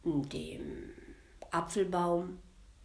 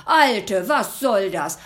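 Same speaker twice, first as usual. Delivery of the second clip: much louder enraged shout; furious devil; 2 semitones higher, 12 dB louder, slower than usual. Acted. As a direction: lunge at her with it. Devil leaps at her throat.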